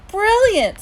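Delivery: very high, loud cheer; elated echo. A narrator's reading. Mom echoing dad's praise, elated. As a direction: ecstatic one-word praise.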